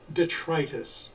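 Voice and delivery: "detritus" said dih-TRY-tus; long-i pronunciation. Retold or imitated. Quoting a radio speaker to the co-host, "detritus" as dih-TRY-tus. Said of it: "and they pronounced it".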